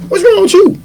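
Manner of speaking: high, sharp mother's question; mom demanding. Retold or imitated. Mom at home asks her sullen son, high and sharp.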